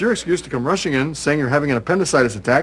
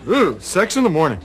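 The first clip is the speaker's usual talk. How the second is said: excited; 6 semitones higher than usual. Real, not acted. Growing glee over neighbor.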